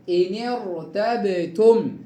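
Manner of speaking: إن ارتبتم slowly, sakin ra thick after the kasra; heavy ra. Shown as a demonstration. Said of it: "مفخمة إيه؟".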